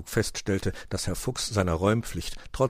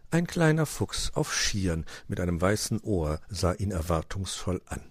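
ordinary delivery, nothing marked

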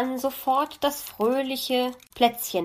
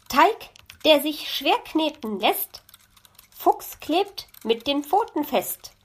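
reciting a couplet; 7 semitones higher than usual. A narrator's reading. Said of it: "fiel ihr auch dazu etwas ein".

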